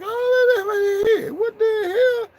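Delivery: very high, shrieking hammed-up cry; squealing mock shock. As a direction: shriek it out in fake alarm. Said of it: "I was like".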